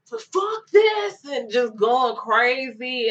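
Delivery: high, loud outburst; angry shout. Retold or imitated. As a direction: yell it out of control. Arrestees raging in paddy wagon.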